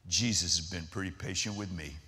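humble and soft. Retold or imitated. Big man humbly answers the counter girl, low and soft. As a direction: say it quietly, meaning it.

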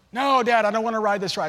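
put-on a scared little girl begging her dad; whiny daughter. Daughter refusing the ride.

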